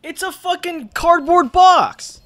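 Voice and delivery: loud, slow, high outrage; exasperated. Real, not acted. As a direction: spell out the disbelief. Outraged at missed kill.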